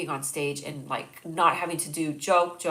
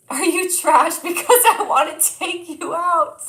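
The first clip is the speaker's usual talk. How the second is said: slow, high, much louder hammed-up pickup line; cheesy pickup line; 10 semitones higher, 10 dB louder, slower than usual. Shown as a demonstration. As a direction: ham up the cheesy line for a laugh. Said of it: "I love your pickup lines".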